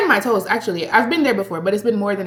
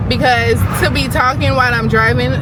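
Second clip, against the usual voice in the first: ordinary talk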